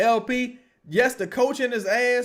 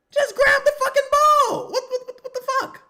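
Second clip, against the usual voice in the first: frustrated yell; 6 dB louder than usual. Real, not acted. Yelling at the team.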